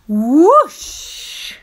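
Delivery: loud sliding whoosh; big swooshing effect. A narrator's reading. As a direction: sweep the sound across the room. Father slides across floor.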